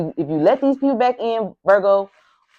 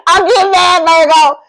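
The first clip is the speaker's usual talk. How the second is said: higher and louder, playful fake rage after a laugh; mock angry outburst; 7 semitones higher, 6 dB louder than usual. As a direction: blow up for show, half joking. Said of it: "'cause I'm getting mad".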